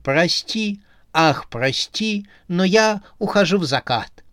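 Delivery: hammy stage actor. A narrator's reading. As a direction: play a ham tragedian bowing out. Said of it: "приняла трагическую позу".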